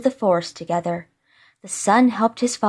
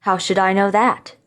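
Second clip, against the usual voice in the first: ordinary delivery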